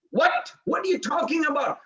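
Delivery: high-pitched disbelief; shocked mother. Retold or imitated. Mother shocked by son's rejection.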